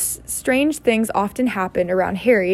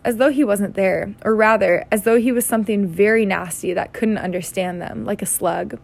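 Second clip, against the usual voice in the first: usual speaking voice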